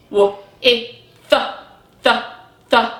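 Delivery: "W, e, th" separately, then "th" repeated; short phonics sounds "W, e, th, th, th" with gaps; sounded out. Shown as a demonstration. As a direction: isolate each sound, repeating the final "th". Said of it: "what the last sound".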